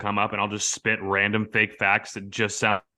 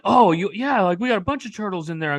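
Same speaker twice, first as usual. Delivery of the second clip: helpful employee; 10 semitones higher than usual. Retold or imitated. Employee helpfully explaining the turtles, high eager voice.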